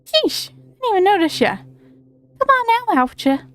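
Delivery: lightly put-on cocky fairy; a hint of a brash fairy calling out; Tooth Fairy voice. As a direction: play a pushy little fairy. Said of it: "I'm giving him that kind of voice".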